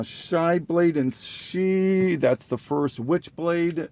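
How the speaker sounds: ordinary talk, nothing marked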